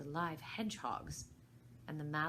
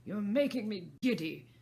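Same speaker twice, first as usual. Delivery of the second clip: angry; 4 semitones higher, 9 dB louder than usual. Acted. Queen's outburst continues.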